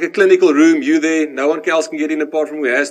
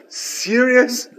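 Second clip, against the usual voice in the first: higher-pitched mock disbelief; acted comic incredulity, pitched up; incredulous; 6 semitones higher than usual. Patient answering the nurse's silly offer, mock incredulous.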